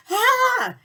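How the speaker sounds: high, loud cry of alarm; mock panicked scream. Seller reading a customer's complaint, mock panicked scream. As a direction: let out a hammed-up shriek.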